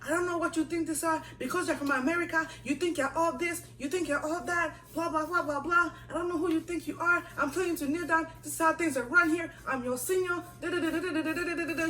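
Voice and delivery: imitating a high-pitched ranting tirade; mock screaming. Senior ranting at kneeling junior, mock screaming. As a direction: rattle on high in a mock scolding. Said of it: "she's now screaming at me".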